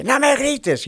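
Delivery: a hammed-up parent yelling no me grites; imitating a yell. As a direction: shout it back while forbidding shouting. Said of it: "y le está gritando".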